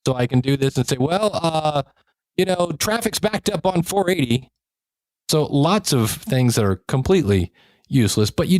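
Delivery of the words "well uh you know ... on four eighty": chopper effect. Shown as a demonstration. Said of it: "I also have a chopper".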